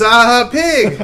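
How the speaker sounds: high, lightly voiced broken-English reply; imitating the brother. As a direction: mimic a man with little English, high up. Brother who speaks no English.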